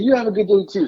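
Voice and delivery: high-pitched cheery reply; retold over-friendly goodbye; pointedly friendly. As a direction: return the pleasantry with extra lift. Returns the pointed pleasantry.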